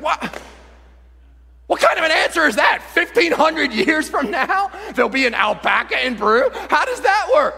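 high, loud disbelief; comic indignant yelling; incredulous outburst. Acted. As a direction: explode in baffled protest at the answer. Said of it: "You're like".

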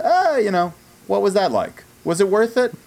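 high breezy throwaway voice; mock casual voice. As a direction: toss it off like a bored fan. Podcast tagline voiced mockingly.

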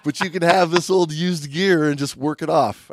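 high, loud mock boss offer. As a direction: play the cheeky dive shop boss. Boss voiced in retold story.

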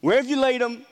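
high, loud call of Jesus asking for the tomb. Retold or imitated. Preacher voicing Jesus calling to the mourners, high and loud. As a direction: call out over the crowd, searching. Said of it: "And he says".

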